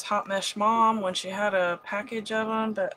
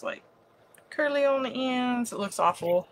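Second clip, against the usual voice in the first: ordinary talk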